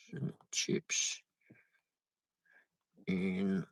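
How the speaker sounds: whispered reading to self; a near-whisper aside; under breath. Real, not acted. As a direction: mouth the words quietly.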